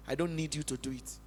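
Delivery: a soft, hushed voice for God; low and gentle, as God speaking; quoting God softly. Retold or imitated. Pastor voicing God's soft reply to him. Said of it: "God was printing to me".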